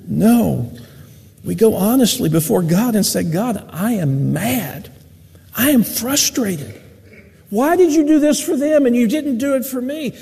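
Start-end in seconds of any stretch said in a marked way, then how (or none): 3.2-10.1 honest frustrated prayer. Retold or imitated. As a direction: pour out a mild mock grievance to God. Voicing honest angry prayer.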